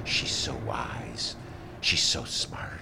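low, slow, hushed mock awe; awed Northsiders. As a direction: murmur reverently, mocking devotees. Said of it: "people are going".

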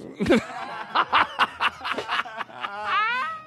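high cackle. Real, not acted. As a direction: cackle with delight. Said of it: "I don't like my cackle".

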